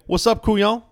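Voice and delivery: high, loud Cajun greeting; mock Cajun accent. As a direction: hail someone in a playful New Orleans Cajun accent. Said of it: "That's what I wanna sound like".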